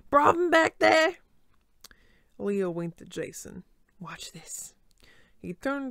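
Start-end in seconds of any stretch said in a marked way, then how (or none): 0.1-1.2 loud, higher barking coach; gruff coach. Acted. Coach shouting at the back row, loud. 4.0-4.7 whispered sly aside; conspiratorial whisper. Acted. Leo winking at Jason, whispering.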